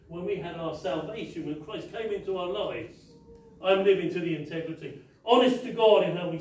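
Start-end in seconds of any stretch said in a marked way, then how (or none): none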